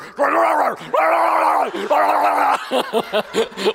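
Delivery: loud, high garbled roaring bursts; mock monstrous bellowing; death metal roar. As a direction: roar unintelligibly like a metal singer. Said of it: "Like, this death metal band".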